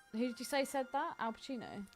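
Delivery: ordinary talk, nothing marked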